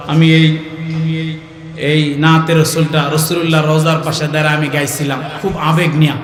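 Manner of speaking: ordinary talk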